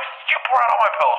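very high-pitched acted yell; high, frantic retold outburst; outward outburst. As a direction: shriek the order in mock fury. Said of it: "But in my head, I'm like".

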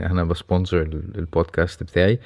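ordinary talk, nothing marked

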